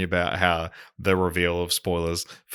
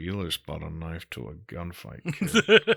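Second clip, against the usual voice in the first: much softer gravelly hero voice, laughing on the last word; Harrison Ford impression; 3 semitones lower, 3 dB quieter than usual. Showing off a Ford impression to the co-host, soft and gruff. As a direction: lean into a weary Ford growl, then crack up on kid. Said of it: "you do a good Ford".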